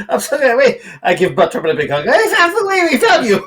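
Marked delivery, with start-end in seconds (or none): 2.1-3.5 excited reunion. Acted. Hugging rescued friend.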